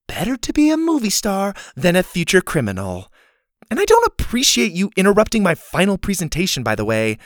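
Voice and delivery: high-pitched, affronted comeback; indignant retort. A narrator's reading. Girl scolding a boy who interrupted her, indignant.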